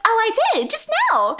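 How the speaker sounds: very high, oblivious voice; dim-witted waitress. Retold or imitated. Dumb waitress answering Spike, high ditzy voice. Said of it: "she's very dumb".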